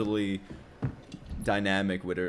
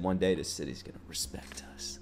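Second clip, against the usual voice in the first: whispered quote; 2 semitones lower, 8 dB quieter than usual. Retold or imitated. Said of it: "but she said".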